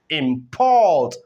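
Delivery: Import with short IM and strong falling PORT; stress on second syllable. Shown as a demonstration. Teacher showing the verb, second-syllable stress on Import. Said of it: "shifted from the first syllable to the second".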